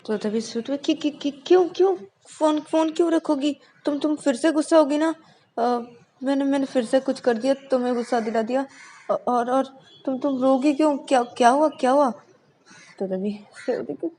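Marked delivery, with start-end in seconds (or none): 1.3-12.1 high, fast worried pleading; anxious girl. A narrator's reading. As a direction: panic that she upset her again. Shruti anxious. (चिंतित, ऊँची और तेज़ आवाज़)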